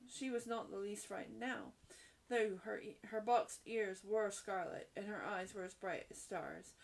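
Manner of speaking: plain reading voice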